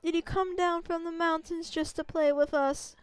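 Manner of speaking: lightly voiced kindly village grandma; a gentle old lady warning the traveler. Old grandma greeting the wolf and its rider, lightly voiced.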